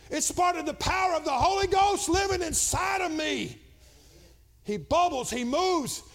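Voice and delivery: rising to a loud, high preaching climax; preaching at full force. Acted. Pastor shouting to his Pentecostal church, preaching at full force.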